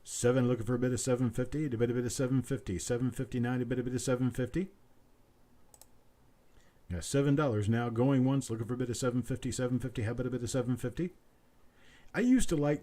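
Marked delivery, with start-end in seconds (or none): 0.0-11.1 rapid auctioneer patter, looping the price; auctioneer bid calling. Acted. Auctioneer calling for bids from stream viewers, quick rolling patter. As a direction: rattle off the bid call like a sale barn auctioneer.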